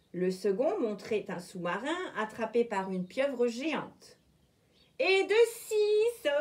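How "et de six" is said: much higher, slow trembling count; wolf shivering at the scary book. A narrator's reading. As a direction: shudder as you say it. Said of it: "frissonna Loup en l'ouvrant timidement".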